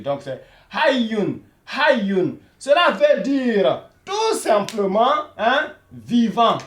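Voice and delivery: hayyun slowly, the y doubled, then the meaning; teacher slowly modelling hayyun and its meaning; slow model word. Shown as a demonstration. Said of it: "il faudrait sentir qu'il y a deux Y".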